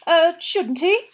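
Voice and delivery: anxious. A narrator's reading. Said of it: "said Mister Weasley anxiously".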